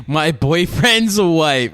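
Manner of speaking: slow, loud, stunned repeat; shocked disbelief. Real, not acted. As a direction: drag out each word in shock.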